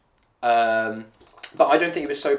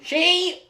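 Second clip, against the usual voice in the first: "she" repeated at a much higher pitch; stress on she; 4 dB louder than usual. Real, not acted. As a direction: insist on "she" with a high, pointed lift. Insisting on the pronoun.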